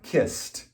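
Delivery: "Kissed" said as kist, softly; soft T ending. Shown as a demonstration. Teacher repeating "Kissed" to show the T ending. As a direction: model "Kissed" with a crisp T. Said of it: "in the past".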